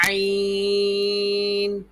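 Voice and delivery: عین squeezed from the throat, held long and high; thin throat ain. Shown as a demonstration. Teacher models for pupils, long throaty عین. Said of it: "حلق سے آواز نکلے اس کی".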